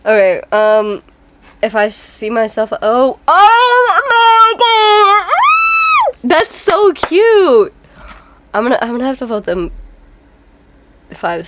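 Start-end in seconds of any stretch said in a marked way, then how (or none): 2.8-7.7 loud, high-pitched drawn-out squealing; gushing, screaming excitement; delighted squeal. Real, not acted. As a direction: squeal with delight, stretching every word. Delighted reaction to cute outfit.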